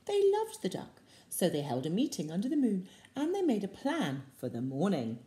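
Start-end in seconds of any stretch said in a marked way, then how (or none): none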